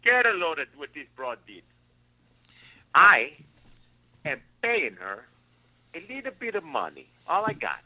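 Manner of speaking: lightly put-on Italian-American accent, loud start; a hint of an Italian wiseguy telling it. Griping about his ex-wife in a light Italian accent. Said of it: "Why is your accent suddenly going into an Italian?".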